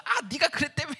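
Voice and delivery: playful accusing call; mock accusation. Son confronts mom after service, mock accusing.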